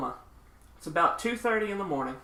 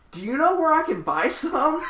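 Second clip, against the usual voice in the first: high, loud, hammed-up hopeful question; eager asking; 11 semitones higher, 5 dB louder than usual. Woman asking vocalist where to buy drugs, eagerly. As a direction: imitate an over-eager stranger, pitch jumping right up.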